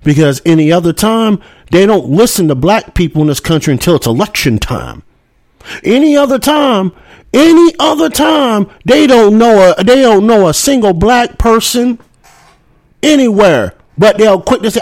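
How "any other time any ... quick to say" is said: rising outrage. Real, not acted. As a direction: let the anger climb higher and higher. Angry rant about government neglect.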